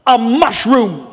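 "mushroom" drawn out slowly, weight on "mush"; stress on mush. Shown as a demonstration. Preacher mocking overnight growth, stress on "mush" in "mushroom". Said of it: "Emphasis on mush".

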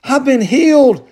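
high, loud burst of astonished joy; amazed joy. Retold or imitated. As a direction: leper suddenly realizing he is healed, high excited exclamation. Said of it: "and one went".

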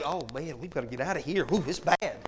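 low, quiet, mock fearful devil; lightly voiced devil panicking to flee; mock scared devil. Pastor mocking the devil, low scared mutter. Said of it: "the devil just said".

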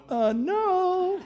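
a startled little yelp of denial, laughing; awkward nervous no. Retold or imitated. Pastor replying to the emotional guy, squeaky and laughing. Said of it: "And I'm going".

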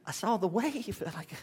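a high put-on voice for Peter admitting fear; sheepish Peter. Peter answering Jesus in a high put-on voice.

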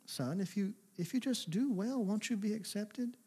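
soft, tender voice of a caring father; softly voiced loving God asking his son; gentle loving God. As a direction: coax him gently, almost hushed. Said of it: "God was loving and gentle".

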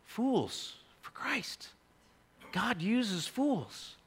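quiet preached conclusion; solemn refrain. Acted. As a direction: let the refrain settle quietly. Closing refrain, slow soft.

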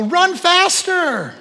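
high, slow mock yell at his son playing football; sideline dad. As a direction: cheer the boy on, drawn out. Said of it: "I go".

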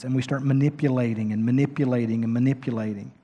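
ordinary delivery

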